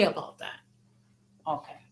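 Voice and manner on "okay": ordinary delivery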